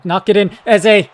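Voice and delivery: loud, high call of the name; willing the ball in; sudden eager shout; excited call. Real, not acted. Cross into the box.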